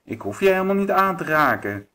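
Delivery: high witch retort; witch calling out. A narrator's reading. Witch answering the wolf's threat, high calling voice. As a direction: call it back, high and taunting. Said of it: "en riep".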